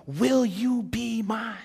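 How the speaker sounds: high, loud, drawn-out plea; impassioned proposal. Acted. Preacher voicing Jesus proposing to you, impassioned. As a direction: call out the proposal slowly and fervently.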